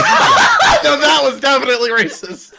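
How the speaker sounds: laughing through the words. Real, not acted. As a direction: giggle it out.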